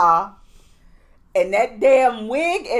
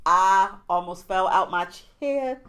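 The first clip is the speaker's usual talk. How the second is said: ordinary delivery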